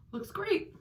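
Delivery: high, bright praise; pleased. Real, not acted. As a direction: lift it up, delighted. Admiring the contour result.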